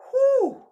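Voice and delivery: loud high whooshing breaths out; emotional exhale. Real, not acted. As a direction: blow out the feeling in sharp high puffs. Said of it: "I'm so emotional".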